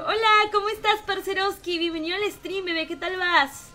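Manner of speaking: excited greeting. Real, not acted. Greets a returning fellow streamer.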